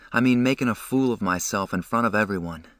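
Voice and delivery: usual speaking voice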